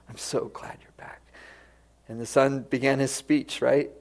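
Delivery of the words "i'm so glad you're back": whispered into his son's ear; tender whisper. Acted. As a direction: whisper it while holding him close. Father embraces returning son.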